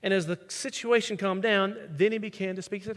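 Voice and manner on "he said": ordinary talk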